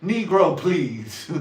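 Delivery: loud, high, laughing scoff; mock dismissal. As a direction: blurt it out as a hammed-up dismissal. Mock dismissal, then repeated quietly.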